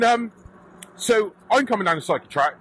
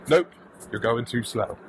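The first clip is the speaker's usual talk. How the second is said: deadpan comeback; 7 semitones lower, 4 dB quieter than usual. Retold or imitated. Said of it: "And I just went".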